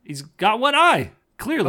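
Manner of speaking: loud, high-pitched insistence; emphatic disbelief. Real, not acted. Insisting to co-host the man is Odin, loud and emphatic.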